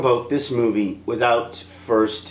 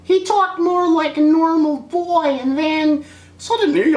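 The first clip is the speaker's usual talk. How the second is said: mock boy voice; slower than usual.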